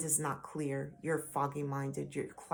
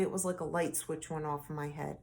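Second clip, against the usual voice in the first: ordinary delivery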